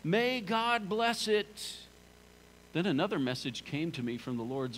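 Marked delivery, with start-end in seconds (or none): 0.0-1.8 raised, slow proclaiming shout; high ringing crowd cry; people shouting. Acted. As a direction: call it out like a crowd blessing. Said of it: "the people will shout".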